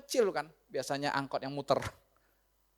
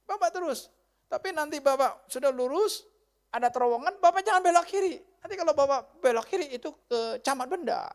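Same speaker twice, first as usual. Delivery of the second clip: high, raised-voice route instructions; insistent directions; 8 semitones higher, 6 dB louder than usual. Acted. Husband warning the driver about a turn, loud and insistent. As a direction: press each turn on the listener.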